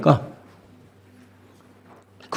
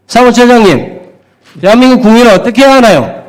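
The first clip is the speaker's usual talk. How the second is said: raised, pressing interrogation; heated demand; 4 semitones higher than usual. Real, not acted. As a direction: hammer the question harder each time. Lawmaker pressing official, rising heat.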